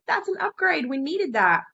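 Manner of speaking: high-pitched mock excitement; retold thrill, pitched well up; excited reaction. Telling a teammate her fish is an upgrade, excited.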